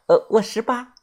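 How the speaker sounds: put-on high girlish reply; young girl. A narrator's reading. Girl tells the narrator her age, high girlish voice. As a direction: answer in a high young girl's voice.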